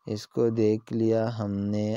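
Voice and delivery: ordinary delivery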